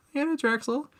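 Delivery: high, meek self-impression; high timid voice. Imitating himself answering the host, timid and high. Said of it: "And he's like".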